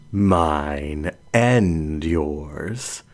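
measured lioness, amused and patient; composed lioness Helen. Acted. Helen's character voice.

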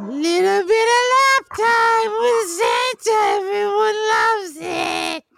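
high, slow, squealing elf; excited elf voice. Acted. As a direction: gush like an overexcited elf. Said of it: "you know me, Santa".

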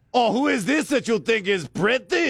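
very high mock-outraged voice; indignant household retort. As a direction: challenge him in a shrieky high put-on voice. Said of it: "I've tried that at my house".

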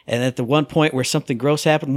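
ordinary delivery

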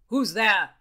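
loud, demanding call; sharp demand. A narrator's reading. Bacon challenges whoever is below the window, loud demand. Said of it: "he demanded".

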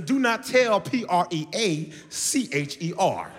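slow, sing-song spelling of each letter; mom spelling it out. Retold or imitated. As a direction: spell it out slowly like a little tune. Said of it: "She said".

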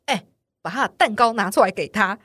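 loud call to the cashier; loud order. Retold or imitated. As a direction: announce it loudly across the counter. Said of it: "很大声地跟那个结账的店员说".

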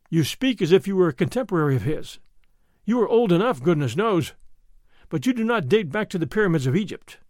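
plain reading voice

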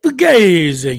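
Italian-accented Fugazi, long, slow and loud; Waco Italian accent. Real, not acted. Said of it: "in that great Waco Italian accent".

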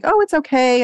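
high, light "it's fine" voice; breezy mock reassurance. Mimicking people who normalize poor health, breezily reassuring.

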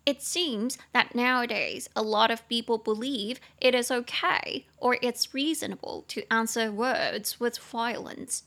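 slow, careful example-sentence reading; reading the sentence aloud. Shown as a demonstration. Teacher reading the English sentence for learners, slow. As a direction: read it out clearly for learners. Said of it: "reading the example sentence".

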